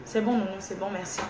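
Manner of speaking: real hushed, placating reply; not shouting. Real, not acted. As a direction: drop right down and say okay softly. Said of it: "je ne crie pas".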